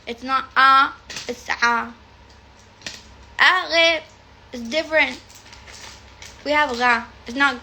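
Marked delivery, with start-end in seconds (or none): none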